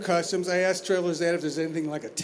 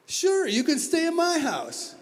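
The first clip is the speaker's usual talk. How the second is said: high, slow friendly offer; eager old man; 11 semitones higher, slower than usual. Retold or imitated. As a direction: lift it way up and draw it out, all welcome.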